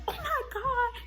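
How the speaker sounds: high, soft squeal of shock; squeaky mock panic; panicked sister. Imitates sister's reaction to crash.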